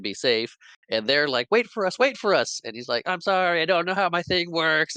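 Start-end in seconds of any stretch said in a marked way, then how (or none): 1.5-2.6 high-pitched imitation of calling after someone; playfully acted plea; mock pleading call. Retold pleas to departing balloonist. 3.1-5.0 high-pitched imitation of a helpless apology; playful high-voiced excuse; mock helpless apology. Retold apology while floating away.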